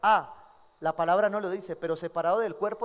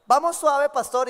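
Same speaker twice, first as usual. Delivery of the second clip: mock young couple; 4 semitones higher, 9 dB louder, slower than usual. Young couple promising to go slow.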